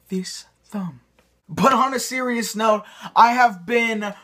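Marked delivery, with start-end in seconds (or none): 0.1-1.0 whisper. Acted. Whispered joke aside.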